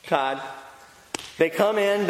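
normal talking voice

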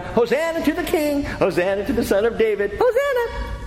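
put-on high, playful kids' cheering, ending in a squeal; high-pitched mock child chant; imitated children. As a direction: mimic kids calling out as they run around. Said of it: "the kids are running around".

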